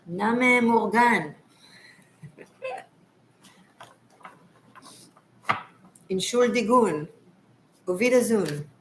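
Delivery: hammed-up German accent; ridiculous German accent. Greeting a German viewer in broken German, ridiculous German accent. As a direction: play up a German accent, drawing out the phrases. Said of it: "Sorry for being ridiculous with the accent".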